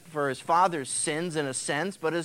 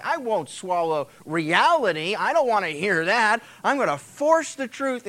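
defiant king; 4 semitones higher, 5 dB louder than usual. Retold or imitated. Ahaziah's defiant rant voiced.

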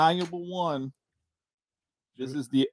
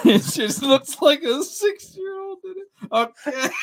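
laughing through the words; giggling while teasing; 11 semitones higher, 5 dB louder, slower than usual. Real, not acted. Laughing at messy handwriting.

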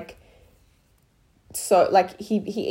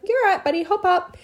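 put-on high, bright forced calm to a hurt child; fake-chill mom; 10 semitones higher than usual. Mum to crying son, high mock-casual. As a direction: mum keeping it breezy for her bleeding son, high and sing-song. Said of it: "trying to be a chill mom".

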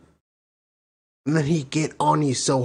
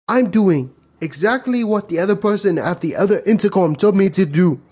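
put-on higher, louder, exasperated North African accent; 6 semitones higher, 3 dB louder than usual. North African driver disputing which lane he took, indignant accent. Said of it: "North African accents".

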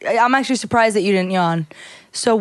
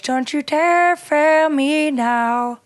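dramatic diva voice; 6 semitones higher, much slower than usual. Acted. Reading dramatic shirt slogan.

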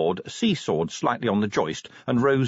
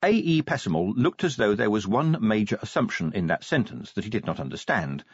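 ordinary delivery

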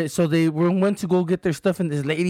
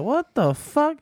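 higher, disbelieving "what the fuck"; shocked disbelief; 8 semitones higher, slower than usual. Retold or imitated. Recalling his shock at the landlady's insult, stunned. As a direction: replay your own stunned reaction. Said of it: "that shit was like".

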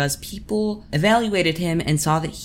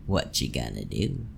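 low defiant taunt; 13 semitones lower, 6 dB quieter than usual. Retold or imitated. Defiant kid with cookie.

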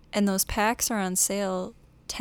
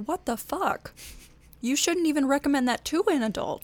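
high, loud disbelief; shocked what the hell; incredulous outburst; 6 semitones higher, 4 dB louder than usual. Retold or imitated. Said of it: "I'm like".

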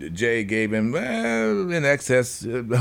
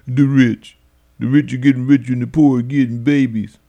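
mock complaint; 5 dB louder than usual. Mocking people who complain.

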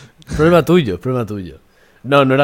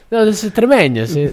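put-on high-pitched, lilting Galician accent; accidental Galician accent, pitched up; slipping into Galician; 8 semitones higher, 4 dB louder, slower than usual. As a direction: try Extremaduran, drift into a Galician accent. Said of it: "me voy a gallego".

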